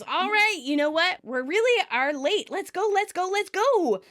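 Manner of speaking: high, urgent rounding-up; bossy hurrying. Retold or imitated. Monica tells friends they are late, urgent. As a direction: chivvy everyone along, pitch climbing.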